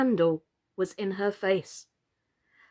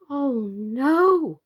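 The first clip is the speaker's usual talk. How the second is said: dismayed. Real, not acted. Reader reacting to Birdie's fate, dismayed. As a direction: react in shock.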